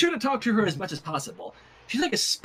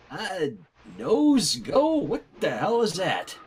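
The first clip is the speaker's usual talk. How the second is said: ordinary delivery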